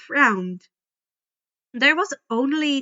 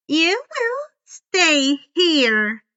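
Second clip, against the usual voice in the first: slow, stern, higher order; cold command; 6 semitones higher, 6 dB louder, much slower than usual. A narrator's reading. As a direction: lay it down slowly like a threat. Miss Dutz commands Percy.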